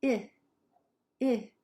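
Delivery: "I" as a brief lax "ih"; short vowel. Shown as a demonstration. Teacher asking students to listen, short "I" said "ih". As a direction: cut "I" short as "ih". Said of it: "that's shorter".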